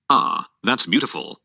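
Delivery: ordinary delivery, nothing marked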